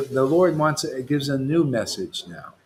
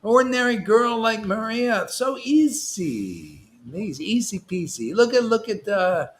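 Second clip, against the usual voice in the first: higher-pitched, carried away with enthusiasm; excited preaching; 6 semitones higher than usual. Real, not acted. Preacher marvelling that an ordinary girl is delivered, excited. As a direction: gush with delight at how easy it is. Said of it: "I just get too excited".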